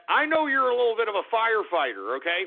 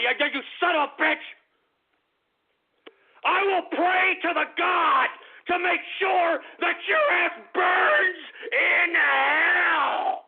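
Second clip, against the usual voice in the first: crying furious shout; 8 semitones higher, slower than usual. Acted. Host cursing the troll, howling and breaking. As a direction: scream it until the voice cracks.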